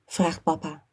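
ordinary delivery

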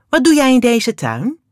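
high, loud stern question; stern demand. A narrator's reading. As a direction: confront the stranger in the garden. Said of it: "zegt ze streng". (streng en luid)

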